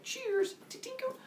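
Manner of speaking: very high, light falsetto toast; mock-dainty toast. Zeus toasts his dad with spiked drinks, high dainty voice. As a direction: chime it like clinking glasses.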